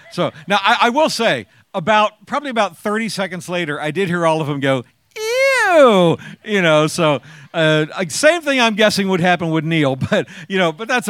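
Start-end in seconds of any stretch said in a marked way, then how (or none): 5.2-6.2 much higher, squealed mock ew; a high disgusted teen squeal; girls going ew. Daughter and friends reacting to dad, squealed ew.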